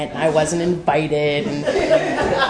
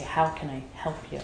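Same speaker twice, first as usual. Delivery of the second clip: much softer, tender question; gentle offer; 3 semitones lower, 12 dB quieter than usual. Retold or imitated. Offering help to a newcomer.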